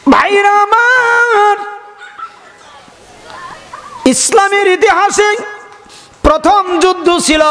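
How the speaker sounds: high, sustained sing-song preaching; impassioned preaching call. Real, not acted. Preacher telling his brothers of the first battle, high melodic call. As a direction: call out high, stretching the phrase endings.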